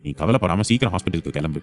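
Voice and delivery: calm his frightened daughter; reassuring father. Acted.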